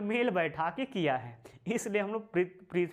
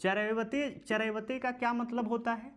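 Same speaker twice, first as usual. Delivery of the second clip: usual speaking voice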